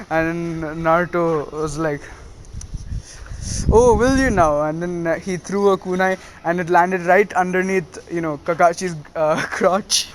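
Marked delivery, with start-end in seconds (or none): 3.7-4.6 higher, louder mock challenge; taunting comeback. Pupil answering his sensei's threat, cocky mock challenge. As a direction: throw the threat back teasingly.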